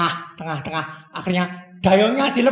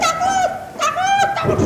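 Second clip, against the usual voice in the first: terrified cry. Acted. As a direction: cry out in terror. Disciples terrified by waves. (murid ketakutan karena ombak, suara sangat tinggi)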